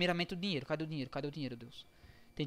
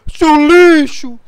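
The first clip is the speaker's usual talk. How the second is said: slow loud mock voice-changer voice; hammed-up imitation of a voice changer; 7 semitones higher, 23 dB louder, much slower than usual. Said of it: "Com modificador de voz".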